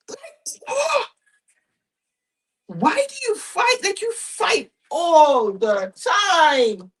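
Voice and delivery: mock despair, voice shooting way up; incredulous. A mother asking her kids why they fight, high incredulous plea.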